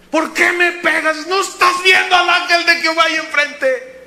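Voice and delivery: high, loud mock protest; mule with man's voice. Beaten mule rebuking Balaam, loud high indignant protest. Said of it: "habló con voz de hombre".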